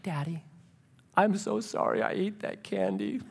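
put-on tiny, hushed little-girl apology; very soft mock-contrite plea; pleading little daughter. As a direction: imitate a child softly wheedling Daddy. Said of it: "she goes into this mode".

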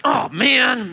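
high exclamation from an astonished doctor; mock dismay. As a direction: play up the doctor's shock. Acts doctor's dismayed reaction.

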